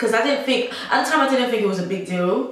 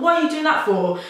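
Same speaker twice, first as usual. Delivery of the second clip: much higher, more indignant repeat of What; retold annoyance, pitched up; 9 semitones higher, 3 dB louder than usual. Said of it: "I got really pissed off".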